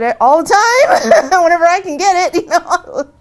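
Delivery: high, loud, laughing through the words. Retold or imitated. Joking reply, laughing.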